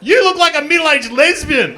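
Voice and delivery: high, loud bratty heckle; loud high kid's jeer; heckling kid. Retold or imitated. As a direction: shout it like a cheeky kid.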